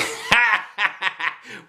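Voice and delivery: high teasing giggle; mocking laughter. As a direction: kids laughing at a classmate. Class laughing at her.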